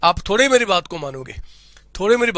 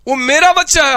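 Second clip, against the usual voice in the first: louder, punching मेरा with pride; proud louder insistence; 5 semitones higher, 6 dB louder than usual. Acted. Teacher insists to doubting student his son is special, louder and proud. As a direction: insist it's your kid, loudly.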